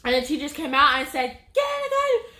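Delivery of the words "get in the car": high-pitched mock yell; re-enacted high cry of command; urgent retold order. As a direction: imitate a teacher urgently calling out. Retold teachers' urgent lockdown order.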